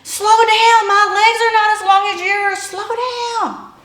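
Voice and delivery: struggling to keep pace and calling out; yelling. Retold or imitated. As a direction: shout to him to slow down. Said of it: "I was yelled at him".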